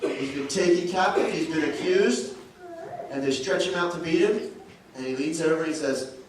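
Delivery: usual speaking voice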